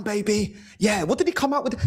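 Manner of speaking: normal talking voice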